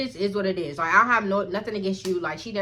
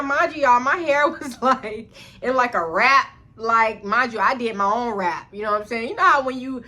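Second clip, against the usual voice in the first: ordinary talk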